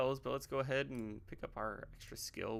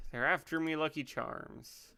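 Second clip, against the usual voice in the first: failed attempt at an Irish accent; higher-pitched, poorly done Irish accent; bad Irish accent; 5 semitones higher, 5 dB louder than usual. Quoting the lucky charms line, bad Irish accent. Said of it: "I can't do an Irish accent".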